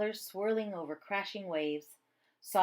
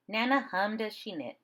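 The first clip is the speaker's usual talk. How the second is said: ordinary delivery, nothing marked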